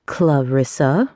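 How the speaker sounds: slow, low drawn-out name with a sniff of contempt; aunt greeting her niece with scorn, slow and low; sniffing disdain. A narrator's reading. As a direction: look down your nose at her. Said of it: "with a sniff of disdain".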